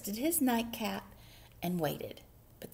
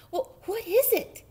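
high, louder mouse voice; sleepy mouse; 13 semitones higher, 6 dB louder than usual. A narrator's reading. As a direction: answer as a woken little mouse. Sleepy mouse answers in story.

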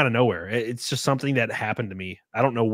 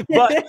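high laugh; 9 dB louder than usual. Real, not acted. Him laughing at himself about fighting, a high burst of laughter.

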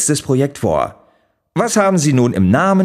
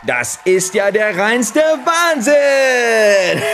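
amazed exclamation; 8 semitones higher, slower than usual. Acted. Game show host marvels at the new car, hammed-up awe. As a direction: gush over the prize like it's a miracle.

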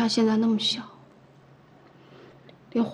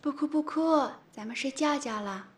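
put-on soft baby-talk coaxing; gentle hushing; 4 semitones higher, 7 dB quieter than usual. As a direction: hush the baby softly, letting it sink quieter. Hushing a crying baby to sleep. (轻声哄宝宝睡觉)

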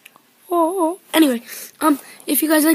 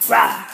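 playful growl; 12 semitones lower, 4 dB louder than usual. Real, not acted.

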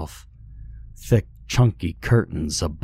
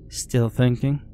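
higher, lightly voiced brittle woman; a touch of coarseness, pitched up; coarse, brittle voice; 6 semitones higher than usual. A narrator's reading. One-eyed woman questioning the captive, lightly coarse and brittle. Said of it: "her voice coarse and brittle".

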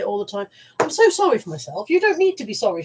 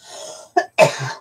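real cough; 7 semitones lower than usual. Real, not acted. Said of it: "go into a coughing fit".

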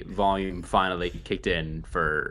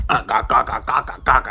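loud repeated "ga ga" grunts; staccato imitation of a video game boxer; Bald Bull noises; 3 semitones higher, 7 dB louder than usual. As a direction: bark out game-boxer gibberish. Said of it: "I'm bald bull right now".

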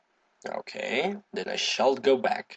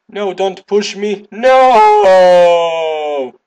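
high plea building to a long loud scream; protesting yell; 8 semitones higher, 12 dB louder, much slower than usual. Real, not acted. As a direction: wail no as you get pushed. Character pushed off in game.

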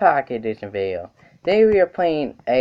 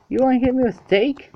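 mocking high voice; 11 semitones higher than usual. Mocking an opponent's weapon.

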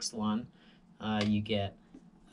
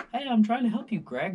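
high, loud pleading voice; mock earnest plea; imitating pleading lifeguard; 10 semitones higher, 6 dB louder than usual. Retold lifeguard girl pleading.

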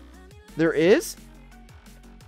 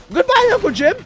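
high-pitched playful goodbye; mock farewell; 11 semitones higher, 4 dB louder than usual. Player bids zombie Uncle Jim goodbye, high mock farewell. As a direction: sing out a gleeful send-off, high.

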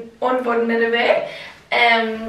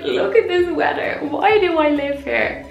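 mock despairing whine; 5 semitones higher than usual. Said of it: "I'm just like".

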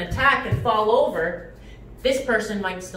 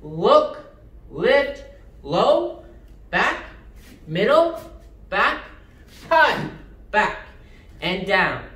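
sharp martial arts commands; slower than usual. Shown as a demonstration. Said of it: "Try the other leg".

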